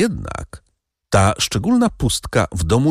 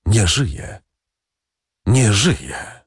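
repeated like a faint distant echo, falling at the end; hollow echo; much slower than usual. A narrator's reading. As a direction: let it sound like an echo of the first voice. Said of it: "niczym echo, drugi głos mówiący".

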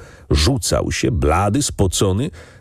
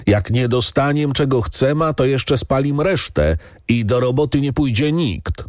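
calling out; 7 semitones higher than usual. A narrator's reading. Farmhand threatens to burn and strike, calling out. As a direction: call out a threat from the crowd, mildly. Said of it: "Jeden głos zaczął wołać".

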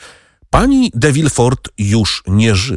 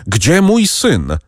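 desperate asking; 6 semitones higher, much slower than usual. A narrator's reading. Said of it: "pytał Wilford".

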